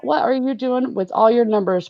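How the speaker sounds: normal talking voice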